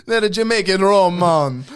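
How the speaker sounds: high, loud mock Jamaican accent; hammed-up Jamaican accent. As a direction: rich kid correcting about rum in mock Jamaican accent. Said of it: "that Jamaican accent".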